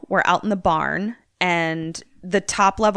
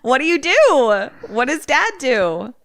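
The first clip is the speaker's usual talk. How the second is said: louder, higher pressing question; demanding an answer now; insistent question; 7 semitones higher, 6 dB louder than usual. Real, not acted. Game master loudly pressing Kim for a decision.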